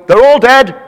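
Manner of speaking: loud, high mock-doom wail; gran's announcement. Gran greets grandchild with bad news, loud high cry. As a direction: cry it out as a doom-laden granny.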